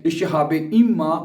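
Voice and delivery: very slow, every German word spelled out for learners; slow dictation. Shown as a demonstration. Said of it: "तो आप कह सकते हैं".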